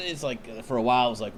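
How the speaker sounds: normal talking voice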